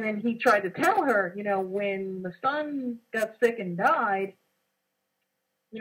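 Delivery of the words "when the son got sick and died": ordinary delivery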